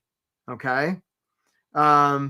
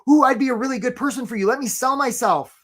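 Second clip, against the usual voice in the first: eager self-selling; 6 semitones higher, 4 dB louder than usual. Retold or imitated. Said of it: "Some of us are like".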